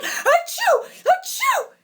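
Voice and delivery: very high, loud acted sneezes; two explosive high-pitched fake sneezes. A narrator's reading. Possessed bride over peppery soup, sneezing loudly. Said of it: "sneezed so loud".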